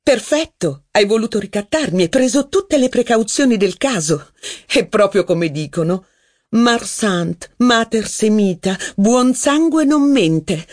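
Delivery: high, mocking jeer; taunting Rachel. A narrator's reading. Rachel mocks Saint Loup's threat to leave, high and scornful. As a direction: needle him in a high scornful voice.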